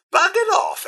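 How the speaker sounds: high, incredulous exclamation; indignant surprise. Acted. Butler finds enemy has fled, high and incredulous. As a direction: exclaim it as if put out.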